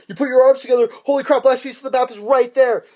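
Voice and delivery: high, loud excited reveal; hammed-up big discovery; mock amazement. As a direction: burst out with the revelation. Acts out discovery of map piece.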